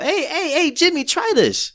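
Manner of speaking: put-on egging a kid on in a silly high pitch; high, goofy come-on; mock prankster. Voicing a prankster egging someone on.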